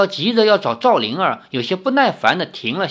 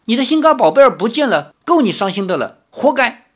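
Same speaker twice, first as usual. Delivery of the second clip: high, loud taunting outburst; petulant stamping anger; 8 semitones higher, 5 dB louder than usual. Acted. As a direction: fling it after someone walking off. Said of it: "林月如在背后跺着脚说".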